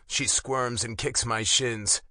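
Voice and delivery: usual speaking voice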